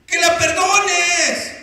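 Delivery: loud, high, drawn-out shout; exasperated command. Acted. Pastor pressing the reluctant husband to forgive, exasperated. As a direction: insist at full voice, stretching it out.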